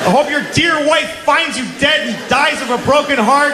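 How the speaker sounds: high-pitched ranting yell, full of spite; re-enacted furious tirade, strained and high; yelling into woods. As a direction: hurl curses at a dead enemy. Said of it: "I yelled into the woods".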